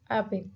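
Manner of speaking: "abbey" with the first syllable stressed. Shown as a demonstration. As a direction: lean on the AB of "abbey", let the end fall. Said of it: "the very first syllable".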